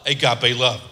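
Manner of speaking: ordinary delivery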